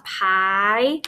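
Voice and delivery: "pie" held as a syllable on its own; long I. Shown as a demonstration. Teacher showing why the syllable is open, long I. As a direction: sound out "pie" with a long eye vowel. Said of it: "that I says its name".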